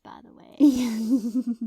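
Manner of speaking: breathy whispered afterthought; a sheepish whispered tag-on; whispered aside. Real, not acted. Whispered aside after bad sentence.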